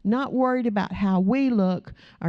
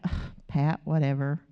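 dismissive groan; 7 semitones lower, 4 dB quieter than usual. Pretend. Imagined bad-day brush-off.

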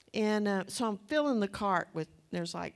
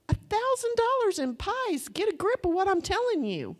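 high, loud, exclaiming; emphatic amazement; 10 semitones higher, 5 dB louder than usual. Real, not acted. Speaker marvelling at donated pies to congregation, excited exclamation. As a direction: exclaim it high, swooping down at the end.

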